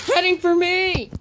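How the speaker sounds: high, loud panicked cry as the boulder comes; frightened cry. A narrator's reading. Gordon cries out as the boulder heads for him, panicked. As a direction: shout it in alarm. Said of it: "cried Gordon".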